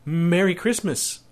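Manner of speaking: put-on high, hesitant little-boy voice; timid stammer in a child's high voice. A narrator's reading. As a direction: stumble on the first sound, then pitch it up like a boy. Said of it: "said the boy".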